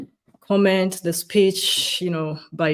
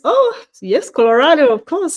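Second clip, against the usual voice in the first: excited; 8 semitones higher, 8 dB louder than usual. Real, not acted. Excited greeting of a viewer.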